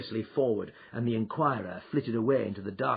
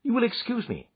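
blandly polite, pitched up; 13 semitones higher, 5 dB louder than usual. Acted. Holmes intrudes on the little man, blandly. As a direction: excuse yourself smoothly. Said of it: "said Holmes blandly".

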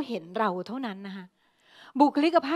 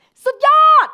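slow, much higher, much louder full-power shout of สุดยอด; 13 semitones higher, 14 dB louder, slower than usual. Shown as a demonstration. Teacher demonstrating the cheer to the class, loud drawn-out shout. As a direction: belt out สุดยอด at the top of your voice. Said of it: "แบบเสียงดังเลยแบบ".

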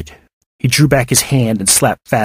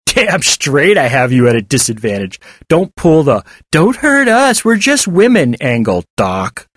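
scornful tough, climbing high through the mimicry; jeering bully; 5 semitones higher, 3 dB louder than usual. David mocks the disguised pair's plea, jeering. Voices David mocking the doctor.

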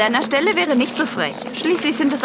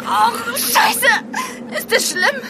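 high, tearful cry; crying fear; 7 semitones higher, slower than usual. Acted. Martina crying as she hears about her knee. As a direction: whimper it out in dread.

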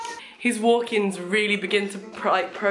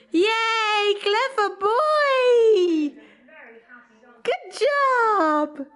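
delighted cheering; 12 semitones higher, much slower than usual. Real, not acted. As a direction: cheer the little one on, stretching the words. Praising toddler's walk with cheers.

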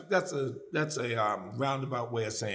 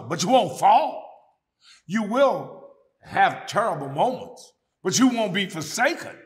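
preaching at full force; 7 semitones higher, 6 dB louder than usual. Acted. Preacher assuring the congregation, rising and loud. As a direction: drive the promise home.

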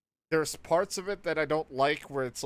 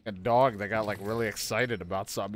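usual speaking voice

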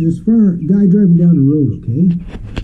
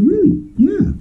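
higher-pitched, lightly voiced rising replies; mock surprised exchange; slower than usual. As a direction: pop both words up high in a mock voice. Quoted exchange in joke.

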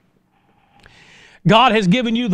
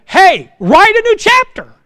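loud, high shout of the command; shouted exhortation; 13 semitones higher, 10 dB louder, slower than usual. Real, not acted. Preacher shouts at the congregation to write anew, loud and high. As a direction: blast it out to wake them.

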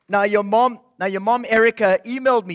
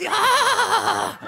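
1 puppet voice; 10 semitones higher than usual. Acted. Puppet wolf speaks.

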